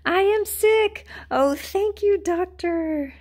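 high happy relief; delighted. Acted. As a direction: say it with glee. Gerald delighted.

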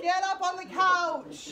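high, loud, slow command; loud insisting. Real, not acted. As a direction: draw out the order, firm. Mom repeats order, then insists.

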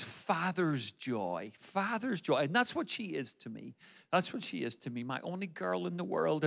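soft, fond father's voice; hushed, heartfelt affection; tender. Real, not acted. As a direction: drop the volume and say it lovingly. Father speaks tenderly of his daughter.

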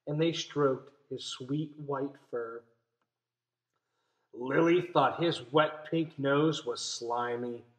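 soft, slow read-aloud; story reading. A narrator's reading. Teacher reading the story to her class, soft and slow. Reads picture book aloud.

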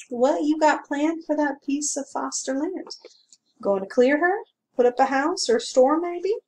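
a hint of a grumbling country constable; small-town constable complaining mildly; gruff constable. A narrator's reading. Constable prying cheerfully.

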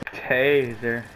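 ordinary delivery

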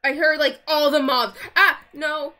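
loud startled cry at the mobs; alarmed outcry. Real, not acted. Player hearing mobs in the cave, a loud alarmed cry. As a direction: blurt it out loud in real alarm.

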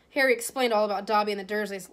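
ordinary delivery, nothing marked

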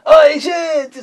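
high, loud, sung-out "Oi, gente"; catchphrase greeting. Shown as a demonstration. Host greeting circus kids from the car, high and loud. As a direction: belt it like a radio host to the crowd. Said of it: "esperando a tua hora".

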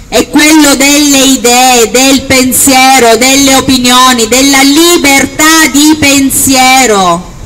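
slow, loud and high climax; booming balcony speech. Acted. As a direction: build each word to the crowd. Rally speech, rising to climax.

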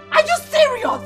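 high, outraged scolding; furious confrontation. Acted. Woman confronting boyfriend who begged her, high and furious.

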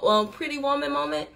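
ordinary talk, nothing marked

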